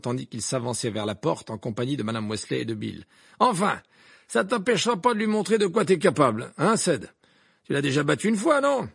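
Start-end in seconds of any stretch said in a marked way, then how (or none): 3.4-8.9 higher, louder, bragging; boastful father. A narrator's reading. Amos Diggory boasting to Cédric, loud. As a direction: talk up your son.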